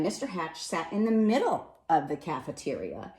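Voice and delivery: ordinary delivery, nothing marked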